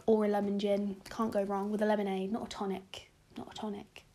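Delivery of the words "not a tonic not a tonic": dropped to a near whisper, repeating it; hushed aside. Real, not acted. Vlogger insisting on lemonade, softly repeated under the breath. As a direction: murmur the correction under the breath. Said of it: "Sorry, guys".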